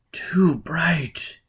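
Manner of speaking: a breathless, pained whisper. A narrator's reading. Burning man shrinks from Foyle's glare, pained whisper. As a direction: breathe it out as if the light hurts. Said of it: "he said".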